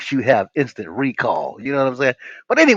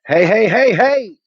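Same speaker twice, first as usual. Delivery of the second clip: loud, high calling out hey; loud greeting; 10 semitones higher, 9 dB louder, slower than usual. Real, not acted. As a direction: hail the viewers from afar. Calling out to viewers.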